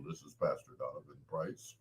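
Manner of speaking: the pastor being proper on the phone; hushed official pastor voice; all official. Retold or imitated. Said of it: "he's all official".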